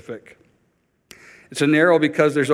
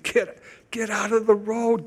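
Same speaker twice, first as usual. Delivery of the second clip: urgent plea; 7 semitones higher than usual. Acted. Urging listeners like a parent.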